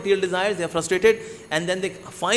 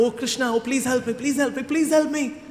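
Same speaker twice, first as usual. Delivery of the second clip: higher, pleading cry to Krishna; pleading prayer; 5 semitones higher than usual. Acted. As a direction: beg for help, voice climbing. Parvati's desperate prayer voiced.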